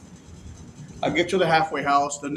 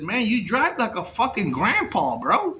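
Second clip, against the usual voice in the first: teasing wife; 4 semitones higher than usual. Retold or imitated. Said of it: "my wife says".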